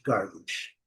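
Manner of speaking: American "garbage" with R, low and soft; low falling American take of "garbage"; American English. Shown as a demonstration. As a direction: say "garbage" as GAR-bidge, American, dropping away. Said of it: "nos Estados Unidos ou Canadá".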